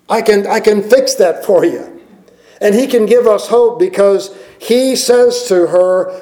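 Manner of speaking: high, driving preacher's voice; full preaching. Acted. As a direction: lift the whole room, preach it hard.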